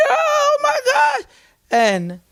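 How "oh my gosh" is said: high, slow "Oh my gosh" squeal of shock; shocked gasp. Retold or imitated. Retelling a crash into a cab, shocked high squeal. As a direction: squeal it out like you just rear-ended a cab.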